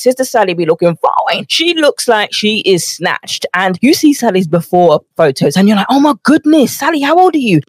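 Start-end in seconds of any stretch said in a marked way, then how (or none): 5.9-7.6 high, gushing mock amazement. Podcaster mimicking fans gushing over Sally's transformation. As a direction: gush over her photos.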